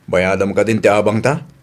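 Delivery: low, put-on voice of an elderly father; old man voice. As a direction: play the old man asking his daughter. Old father figure asks. (boses ti lakay)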